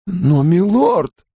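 high, drawn-out servant's plea; dismayed protest. A narrator's reading. Servant objecting to his lord burning the letters, dismayed. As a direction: protest slowly, voice climbing high.

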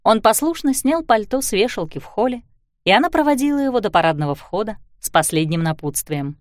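normal talking voice

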